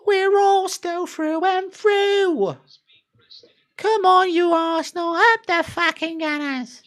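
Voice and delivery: very high, drawn-out London terrace shout; hammed-up London fan cheering on the team; London accent. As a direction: belt it out in a mock London falsetto. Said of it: "like, a London accent".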